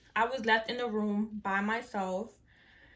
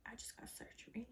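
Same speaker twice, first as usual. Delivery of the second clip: breathy faint whisper of disbelief; groggy whisper; 23 dB quieter than usual. Retold or imitated. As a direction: whisper it half awake. Groggy whisper after surgery.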